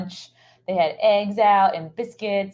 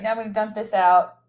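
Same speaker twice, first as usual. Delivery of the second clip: ordinary delivery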